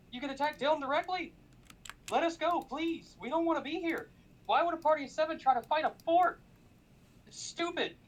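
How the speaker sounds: high, pleading adventurer begging to be let go; desperate pleading. Retold or imitated. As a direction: beg the fort captain, pitch climbing high. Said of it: "I'm like".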